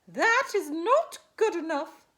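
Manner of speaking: high, loud, emphatic godmother voice; hairy godmother. A narrator's reading. Godmother rejects the vague answers, high loud scolding. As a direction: declare it sternly, high and loud. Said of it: "said the hairy godmother".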